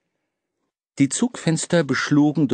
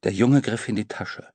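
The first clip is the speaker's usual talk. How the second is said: usual speaking voice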